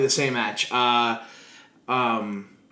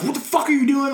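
high pitched mock yell; yelling at a partner from the turnbuckle; retold yell; 12 semitones higher, 3 dB louder than usual. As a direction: bark it at him from the buckle. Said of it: "cussing at him and yelling shit".

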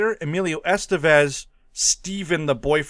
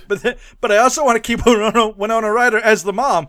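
fast, loud and eager; excited planning; 5 semitones higher, 7 dB louder, faster than usual. Real, not acted. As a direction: rush it out excitedly. Excited casting.

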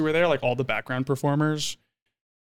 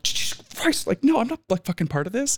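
exasperated; 10 semitones higher than usual. Retold or imitated. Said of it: "I was like".

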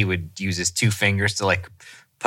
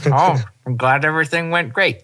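higher, mumbled mouthguard voice; talking through a night guard; 5 semitones higher than usual. Retold or imitated. Husband chatting to his wife with a night guard in. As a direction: say it around a plastic guard in your teeth. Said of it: "talk to my wife and I'm just like".

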